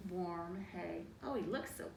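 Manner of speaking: usual speaking voice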